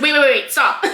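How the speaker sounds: loud, laughing flustered protest; giggly hammed-up alarm; mock panic. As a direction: play at frantically calling a halt. Acting out her flustered panic.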